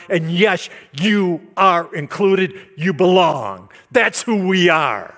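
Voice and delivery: loud, high preaching proclamation; emphatic preaching. Acted. Preacher telling the church they belong, loud and emphatic. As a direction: lift it up as good news.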